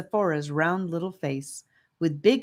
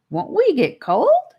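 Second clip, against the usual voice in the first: put-on worried child question; 12 semitones higher, 8 dB louder than usual. Charlie asks worried question.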